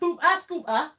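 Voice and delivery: high, loud mock Geordie; Geordie accent. Host runs yesterday's practice phrases, loud Geordie accent. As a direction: punch out nonsense in cartoon Geordie. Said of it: "Do Geordie".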